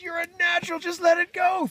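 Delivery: very high, loud coaching; urgent cheering. Acted. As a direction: shout the layer through the push. Rooster cheers on second push.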